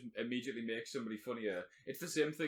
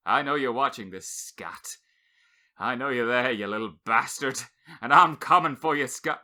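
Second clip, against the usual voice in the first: hammed-up menace, louder on the threat; mock threat; 3 semitones lower, 8 dB louder than usual. Teasing a listener named Scott, hammed-up menacing threat. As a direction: playfully threaten him, half-shouting.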